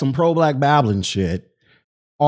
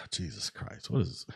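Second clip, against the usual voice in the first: exasperated sigh; 8 semitones lower, 17 dB quieter than usual. Real, not acted. Streamer exasperated reading rude chat.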